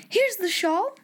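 high, light exclamation; mild delighted cry. A narrator's reading. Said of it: "she cried".

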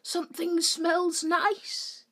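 high, slow, drawn-out awkward remark; Jim. Acted. Awkward neighbour sniffing round Jackie's dinner, high slow put-on voice. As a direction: stretch it out like a socially awkward neighbour. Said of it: "I'm literally the female version of Jim".

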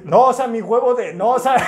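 high, loud, stumbling mock excuse; flustered panic. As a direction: scramble to explain, hammed-up. Retelling his flustered excuse in class. (pánico exagerado, tratando de justificarse)

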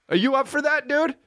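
usual speaking voice

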